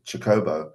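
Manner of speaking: Chocobo said chuh-KOH-boh; second-syllable Chocobo. Retold or imitated. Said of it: "heard someone pronounce it".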